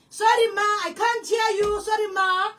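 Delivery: loud put-on phone brush-off; feigned bad line. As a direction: pretend you can't hear the caller. Acted phone fob-off retold.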